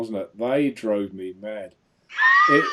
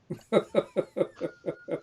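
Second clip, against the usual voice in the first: high squeal of laughter; shrieking laugh. Real, not acted. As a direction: burst out squealing. Laughs at drinking joke.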